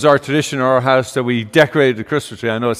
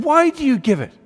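put-on bewildered child; 7 semitones higher, faster than usual. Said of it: "I said".

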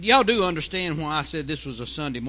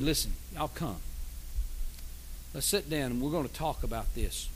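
much softer, gentle coaxing call; tender invitation; 5 semitones lower, 12 dB quieter than usual. Acted. Preacher as God gently inviting strays to sit and talk. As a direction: coax them in kindly, like a father calling.

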